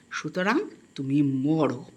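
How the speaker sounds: usual speaking voice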